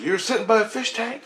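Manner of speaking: high, rising disbelief; retold astonished question. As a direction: echo it back in amazement. Acting out Christ's astonished question.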